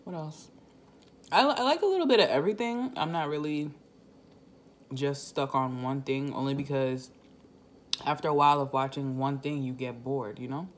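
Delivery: chewing while talking; slurping between words; mouth full of noodles. Real, not acted. Seen 3: Noodle forkful held at mouth throughout, chewing while speaking.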